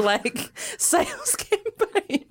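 high voice breaking into laughter; laughing helplessly mid-sentence; cracking up. Real, not acted. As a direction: struggle to get it out through giggles. Cracking up at her own story.

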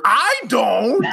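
high yelled denial; hammed-up high yell; mock shout. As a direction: shout it up high like the angry host. Retelling Tim Robinson's shouting.

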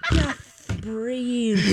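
"Just breathe" lisped, s sounds softened; lisping "Just breathe" again. Retold or imitated. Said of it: "M C keeps reenacting".

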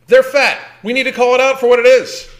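loud, high, insistent rant; emphatic indignation. Real, not acted. Blunt rant, calling it out.

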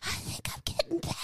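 whispered, raspy, fast; croaky whisper. Retold or imitated. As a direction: croak it out like she's losing her voice. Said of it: "She was like".

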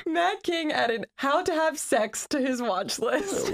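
high, slow, laugh-strained delivery; barely holding back laughter. Real, not acted. Revealing a friend's watch list, stifling laughter. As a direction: force it out slowly between giggles. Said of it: "cracking up".

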